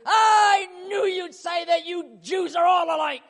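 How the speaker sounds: indignant shout. Pretend. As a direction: snap back in mock outrage. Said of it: "He's waiting to see".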